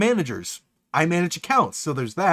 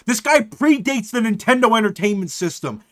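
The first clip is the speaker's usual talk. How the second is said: usual speaking voice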